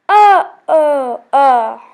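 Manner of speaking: a loud, half-laughing mock groan; hammed-up groans of a sore castaway. A narrator's reading. Martin waking up hurt on the beach, mock groan. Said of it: "groaned Martin".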